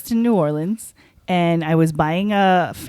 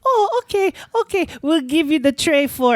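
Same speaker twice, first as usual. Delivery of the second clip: higher-pitched quoted seller relenting; lightly voiced vendor, pitched up; 8 semitones higher than usual. Quoting a vendor, acted.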